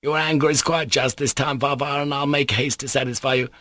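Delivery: high-pitched, fussy, voluble man; excitable and ceremonious, pitch held up; effusive captain. Acted. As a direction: gush with elaborate courtesy. Captain's effusive speech in story.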